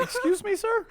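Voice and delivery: very high, incredulous squeak; shocked excuse me. Retold or imitated. Reacting to the driver's creepy offer, high shocked squeak. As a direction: shoot it way up in stunned disbelief. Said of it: "Yeah, I went".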